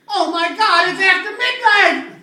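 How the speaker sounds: high loud slow alarm; high, loud cry of alarm; panicked shout. Retold or imitated. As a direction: yelp it in panic. Pumpkin man panicked shout.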